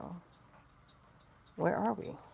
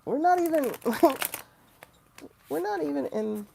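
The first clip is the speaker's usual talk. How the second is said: laughing protest; 9 semitones higher, 6 dB louder than usual. Real, not acted. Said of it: "laughing through protest".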